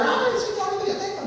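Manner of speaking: high mock wail to the Lord. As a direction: ham up a sobbing prayer, then drop it. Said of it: "No empezó a llorar".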